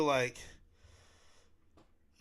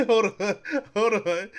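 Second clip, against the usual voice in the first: laughing; 6 semitones higher, 8 dB louder than usual. Real, not acted. Cracking up at his own impression.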